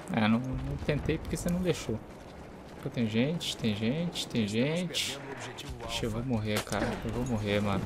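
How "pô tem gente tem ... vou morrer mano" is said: hushed panicked muttering; quiet, tense, about to die; panicking gamer. Real, not acted. Enemies near, fears dying in game.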